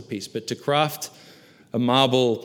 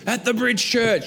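high, slow whiny church complainer; mock grumbling; 10 semitones higher, slower than usual. Preacher mimics a church member grumbling, high whiny mock. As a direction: whine it like a petty grumbler.

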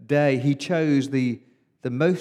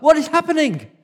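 much higher, louder alarmed question; startled cry; 14 semitones higher, 9 dB louder than usual. Retold or imitated. Boy woken by screams at midnight, startled high cry. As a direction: jolt awake and cry it out, high and loud.

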